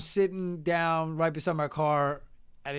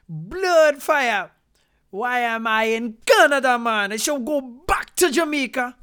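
loud, higher, upset Jamaican man; aggravated Jamaican accent; 6 semitones higher, 8 dB louder than usual. Retold or imitated. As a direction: rant in a thick Jamaican accent. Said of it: "behind me was this Jamaican guy".

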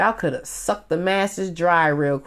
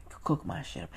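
unvoiced whisper; whispered aside; 5 semitones lower, 14 dB quieter than usual. Real, not acted. Vlogger musing about cooking fish on an island, whispered. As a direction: whisper it to yourself.

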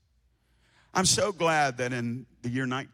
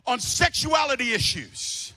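loud, high, driving sermon voice; fiery pulpit preaching; full-force preaching; 12 semitones higher, 5 dB louder than usual. Acted. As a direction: preach it hard to the room.

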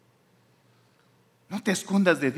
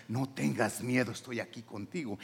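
tender reassurance; 6 semitones lower, 10 dB quieter than usual. Acted. God embracing a believer, soft tender reassurance. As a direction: lower the voice and soothe gently.

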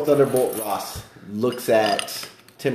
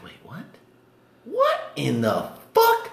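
disbelief; 9 semitones higher, slower than usual. Retold or imitated. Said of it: "And I was like".

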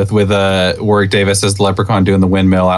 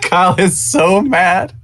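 laughing; 11 semitones higher, much slower than usual. Real, not acted. Teasing a friend after his loss.

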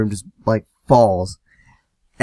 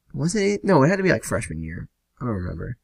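ordinary delivery

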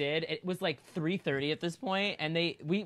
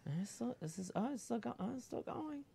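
nervous muttering; 6 semitones higher, 13 dB quieter than usual. Retold or imitated. Stuck on zip line, anxious.

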